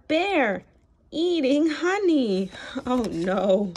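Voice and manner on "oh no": ordinary delivery, nothing marked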